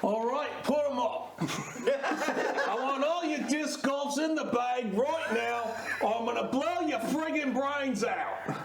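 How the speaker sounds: hammed-up outlaw threat with an Aussie accent; Western gunslinger. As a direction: hold them up like a comic gunslinger. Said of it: "We shall go into a Western".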